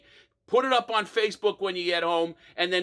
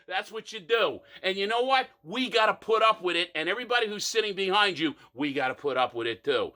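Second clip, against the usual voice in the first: normal talking voice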